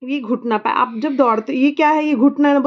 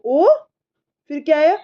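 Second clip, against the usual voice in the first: ordinary talk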